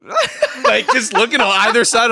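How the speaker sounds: high-pitched laughing through the words; laughing hard. Real, not acted. Friends mocking the audition scene, high laughing delivery. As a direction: crack up while describing it.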